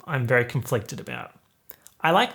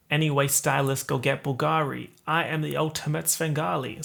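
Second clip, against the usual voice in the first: American accent; 2 semitones higher than usual. Shown as a demonstration. YouTuber demonstrating a favourite lyric, American accent. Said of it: "doing an American accent".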